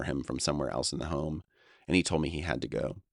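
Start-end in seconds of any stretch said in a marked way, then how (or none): none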